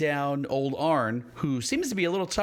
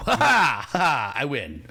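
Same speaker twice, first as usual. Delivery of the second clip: sneering panto villain; 6 dB louder, slower than usual. Acted. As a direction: gloat like a smug baddie. Villain gloating at players.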